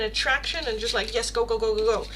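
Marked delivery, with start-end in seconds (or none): none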